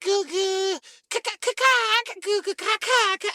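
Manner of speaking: put-on high baby gurgling; playful baby babble. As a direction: goo and ka like a cooing infant. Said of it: "the kid said".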